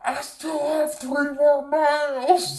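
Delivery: slow, high, loud shout of frustration; retold shout. Shouting to family at the finish line, high and loud. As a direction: yell it out, dragging each word. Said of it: "I just shouted at them".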